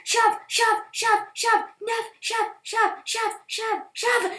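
high, loud chant; repeated shouts. Real, not acted. Shoving the pig into the pen, shouting each push. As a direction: bark each word hard.

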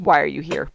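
"Why are you here" low and falling, as a statement; no question mark. Retold or imitated. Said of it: "No question mark".

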